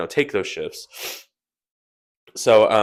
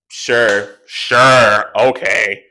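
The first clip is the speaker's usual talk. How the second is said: louder, drawn-out mock "sure, sure, okay"; imitating the fiancé, louder and stretched; mock fairy voice; 9 dB louder, much slower than usual. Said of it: "And he talks like".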